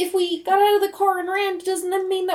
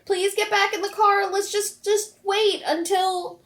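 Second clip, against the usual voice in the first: put-on louder, pleading Southern kid; pleading child; 4 semitones higher, 6 dB louder than usual. Child begging her dad to get back in the car, pleading. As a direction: beg dad to come back in.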